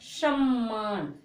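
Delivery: सम्मान drawn out slowly, the half म doubled into मान; सम्मान said slowly as an example word for the class; slow teaching demo. Shown as a demonstration. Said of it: "क्या बोलोगे?".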